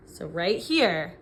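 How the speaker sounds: ordinary talk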